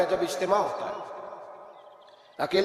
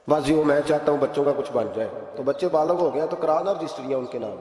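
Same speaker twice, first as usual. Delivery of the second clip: ordinary talk, nothing marked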